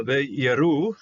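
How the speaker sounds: slow careful Hebrew reading; reading Hebrew aloud. Shown as a demonstration. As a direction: sound out the Hebrew word. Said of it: "this is the plural form".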